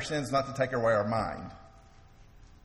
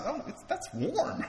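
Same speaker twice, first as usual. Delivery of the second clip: pleasantly surprised bird; 13 semitones higher, slower than usual. Acted. Bird in the joke voiced.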